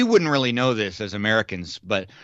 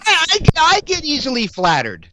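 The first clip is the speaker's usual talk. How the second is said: much higher, louder, mock squeaky flattered voice; 14 semitones higher, 4 dB louder, slower than usual. Host teases his cohost in a mock squeaky, flattered voice. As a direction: squeak it out like a giddy fan. Mock high voice after teasing.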